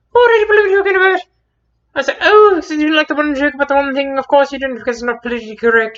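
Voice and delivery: very high, loud, offended outburst; shrill-free but sky-high, loud mock outrage; indignant retort. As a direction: fire back, wounded and indignant. Retorting to a heckler.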